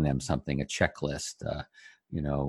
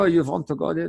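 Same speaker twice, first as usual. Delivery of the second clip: lightly put-on German accent, higher pitched; 9 semitones higher, faster than usual. Speaker voices a local recommending a restaurant, mock German accent. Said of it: "that was my German accent".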